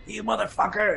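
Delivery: high mock snarl. Friend retelling the horror scene, silly high snarl. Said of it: "and he's just like".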